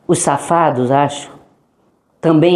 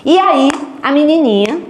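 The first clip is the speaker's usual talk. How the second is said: a touch of excited teasing; light excited lead-in; 7 semitones higher than usual. Retold or imitated. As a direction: tease them with the girl's story. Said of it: "announcing the girl".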